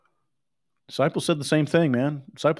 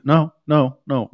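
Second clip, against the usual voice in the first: a sudden louder no, no, no; loud refusal; 7 dB louder, slower than usual. Real, not acted. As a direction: cut them off with a firm no. Rejecting the wrong answer.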